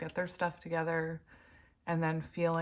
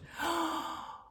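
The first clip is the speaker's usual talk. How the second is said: a quick, high, startled gasp; sharp gasp; 11 semitones higher than usual. Real, not acted. Reader gasping at the card she drew. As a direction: catch your breath in surprise.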